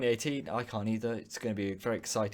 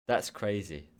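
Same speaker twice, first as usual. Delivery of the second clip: ordinary talk, nothing marked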